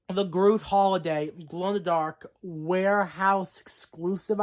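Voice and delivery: normal talking voice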